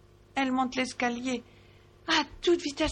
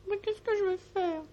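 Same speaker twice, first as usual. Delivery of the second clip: high despairing mouse; 10 semitones higher than usual. A narrator's reading. Sourisette asks despairing.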